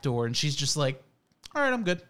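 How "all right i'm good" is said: higher, offhand dog reply; lightly voiced easygoing pet; contented dog. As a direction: casually wave it off as the dog.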